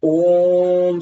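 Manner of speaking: ओम held long and slow; drawn-out om. Shown as a demonstration. Teacher demonstrating pluta, ओम held slowly. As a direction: stretch ओम until the breath runs out. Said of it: "सबसे अच्छा उदाहरण ओम".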